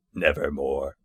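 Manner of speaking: soft, low, eerie croak of a word; raven's reply. Acted. Raven answering the grieving scholar, hushed and eerie. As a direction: let the bird answer, hushed and hollow. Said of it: "Quoth the raven".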